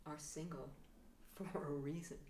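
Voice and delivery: ordinary talk, nothing marked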